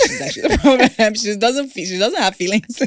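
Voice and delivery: high, loud teasing through laughter; laughing tease. Real, not acted. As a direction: tease her, laughing as you talk. Teases guest while laughing.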